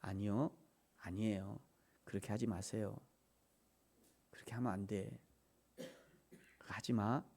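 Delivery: very soft, low pleading; hushed, low, gentle correction; still small voice. Shown as a demonstration. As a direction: murmur the warning gently. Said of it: "그냥 세미하게".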